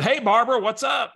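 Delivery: high, loud bright hello; cheery greeting. Retold or imitated. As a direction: greet her like an old friend. Cheerful greeting to unknown caller.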